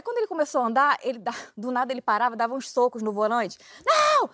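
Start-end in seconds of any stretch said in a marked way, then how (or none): 3.8-4.3 high, slow yell of despair; retold yell. Driver screaming in the car, a high drawn-out shout.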